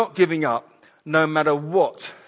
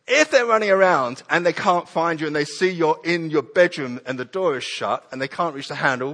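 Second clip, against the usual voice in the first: ordinary talk, nothing marked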